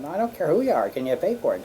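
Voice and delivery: a backwoods mechanic who won't budge. Retold or imitated. Mechanic unimpressed by her name, loud and blunt. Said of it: "he said".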